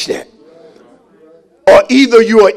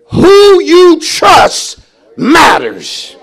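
slow, punched pulpit declaration; emphatic preaching; 5 semitones higher, 3 dB louder, much slower than usual. Real, not acted. Preacher on who you trust, emphatic preaching. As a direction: drive each word home to the congregation.

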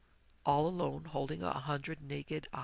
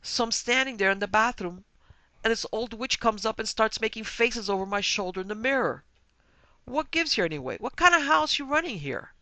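loud, higher, indignant husband's outburst; agitated booming; 6 semitones higher, 8 dB louder than usual. Acted. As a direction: boom it out, building to an outraged demand. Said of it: "Mister Talmadge's agitated voice booming out".

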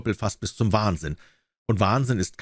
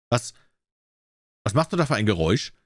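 angry question; faster than usual. A narrator's reading. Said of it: "fragte der König".

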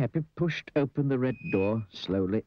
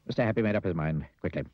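ordinary delivery

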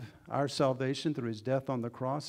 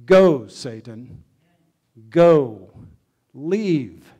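booming, slow, much louder rebuke; loud command; 18 dB louder, much slower than usual. Acted. Jesus driving Satan away, booming loud and slow. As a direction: thunder it out, banishing him. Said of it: "And I think he said it loudly".

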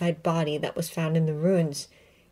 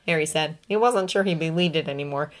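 usual speaking voice

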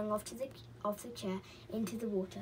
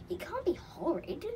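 higher-pitched, longing protest; 8 semitones higher than usual. A narrator's reading. Molly protests about the island, longingly. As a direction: read it as a child's wistful objection. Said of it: "said Molly longingly".

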